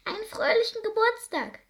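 high squeak of a tiny mouse; high squeaky mouse greeting. A narrator's reading. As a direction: peep it like a little mouse. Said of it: "piepste sie".

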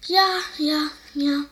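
the animals sobbing yes for the lost bird; wailing animals. A narrator's reading. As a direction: wail agreement together. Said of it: "heulten die anderen Tiere".

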